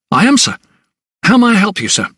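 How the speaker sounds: high-pitched, obliging clerk voice; helpful clerk. A narrator's reading. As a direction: answer high and deferential, ready to serve. Helpful new clerk greets the visitor.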